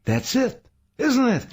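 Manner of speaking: very high, loud sudden insight; gasped realization. A narrator's reading. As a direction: gasp it out as it dawns. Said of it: "Saul gasped".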